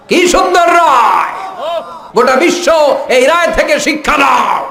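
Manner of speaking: soaring, stretched shout of acclaim; exultant preacher's shout. Real, not acted. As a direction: belt it out to the whole crowd. Same words cried out to crowd.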